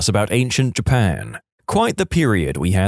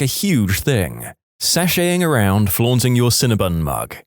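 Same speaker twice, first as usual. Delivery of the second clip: plain reading voice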